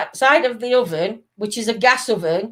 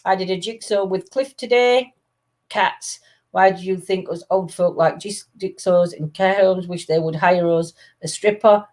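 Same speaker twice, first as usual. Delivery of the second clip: ordinary talk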